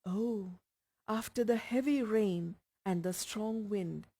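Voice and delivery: plain reading voice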